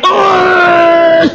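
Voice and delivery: long, high held "oh" scream; demo scream. Shown as a demonstration. Showing the pastor how to yell, screaming. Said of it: "You got to scream".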